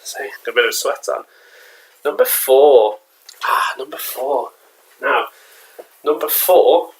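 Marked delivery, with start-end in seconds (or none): 2.0-3.0 loud announcement of number four; announcing. Acted. Announcing countdown item, first take. 3.4-4.5 slow musing. Acted. Repeats item announcement, second take.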